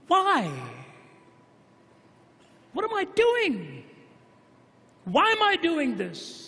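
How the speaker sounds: high, pleading voice breaking with despair; anguished cry. Retold or imitated. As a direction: cry it out to yourself in the dark. Man weeping alone at night.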